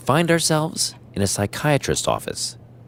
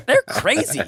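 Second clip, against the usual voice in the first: laughing; 13 semitones higher than usual. Real, not acted. Laughing at Chucky movies.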